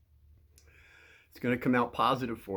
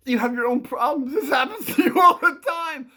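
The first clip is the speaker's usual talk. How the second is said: higher, louder, run together and breaking into laughter; cracking up; 10 semitones higher, 7 dB louder than usual. Real, not acted. Telling a kid off, cracking up. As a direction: blurt it out in one go, laughing. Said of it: "I'm just trying to keep a straight face".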